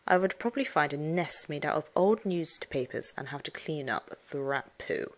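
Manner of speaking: ordinary delivery, nothing marked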